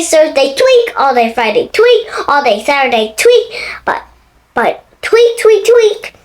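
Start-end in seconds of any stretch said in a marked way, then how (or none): none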